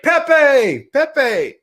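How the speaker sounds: high, loud repeated call. Retold or imitated. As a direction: yell his name up the hill again. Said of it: "he yells up to Pepe".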